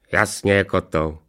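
smirking dog replying to his mate; sneering hound. A narrator's reading. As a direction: agree with a sneer. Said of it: "ušklíbil se Fekota". (ušklíbnutý chrt)

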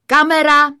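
loud, high shout to the crew; director's call. A narrator's reading. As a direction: call it out across the set. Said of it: "volal režisér". (hlasité režisérovo zvolání)

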